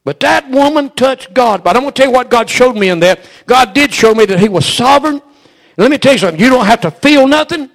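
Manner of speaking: loud, rising preacher's cadence; full-force preaching. Acted. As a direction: drive it home to the congregation.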